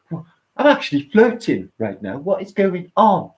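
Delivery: bad impression of a blissed-out friend, not yet Cockney; failed Lee impression. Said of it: "he don't talk like that".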